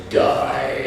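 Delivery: whispered alien snarl. Retold or imitated. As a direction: hiss it like a cold alien. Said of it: "the alien goes".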